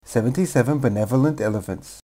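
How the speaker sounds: speeding up. Shown as a demonstration. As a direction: speed it up with every repeat. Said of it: "Say the tongue twister faster and faster and faster".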